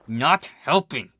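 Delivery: slow, pressed through clenched teeth; through gritted teeth. A narrator's reading. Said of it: "through her gritted teeth".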